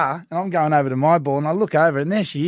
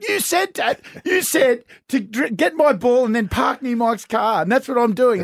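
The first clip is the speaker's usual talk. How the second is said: put-on high childish protest, slowing mid-line; imitating daughter; 7 semitones higher than usual. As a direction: plead your case like a kid. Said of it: "she said".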